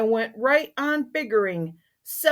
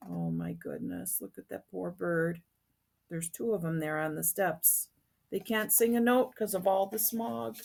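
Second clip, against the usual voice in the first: hushed aside; 2 semitones lower, 10 dB quieter than usual. Real, not acted. Storyteller's hushed aside to listeners.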